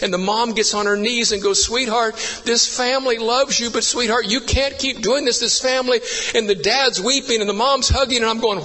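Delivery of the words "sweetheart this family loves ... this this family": imitated plea, raised pitch; higher, earnest appeal to a husband; retold imploring; pleading wife.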